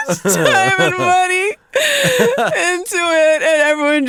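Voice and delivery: high, drawn-out tearful outburst; mock tearful wail. Comedian lamenting money sunk into her album, mock tearful wail. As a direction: wail it as if falling apart, half laughing.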